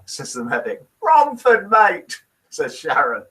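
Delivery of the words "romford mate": put-on Essex accent. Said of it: "she says it with an Essex accent".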